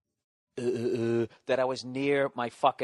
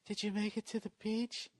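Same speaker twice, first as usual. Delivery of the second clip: higher, sleepy mock wife voice; a sweetly clueless wife, just waking; wife mumbling from bed, lightly mocked; imitating wife; 10 semitones higher, 7 dB quieter than usual. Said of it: "She's like".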